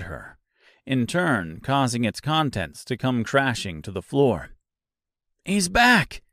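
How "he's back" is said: sharp high yell. Acted. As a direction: shout the warning, high. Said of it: "yelled the Scarecrow".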